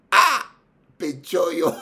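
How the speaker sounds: high, loud exclamation; hammed-up astonishment; mock amazement. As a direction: gasp in exaggerated wonder. Acts out astonished reaction.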